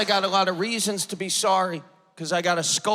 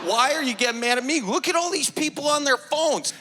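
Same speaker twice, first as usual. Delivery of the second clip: indignant protest; 9 semitones higher than usual. Retold or imitated. Arguing with usher in church.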